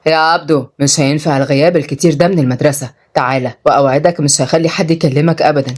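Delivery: low, measured reassurance; calm. Acted. Oday to Abdo about missing school, calm. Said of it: "وعدي بيقول بهدوء".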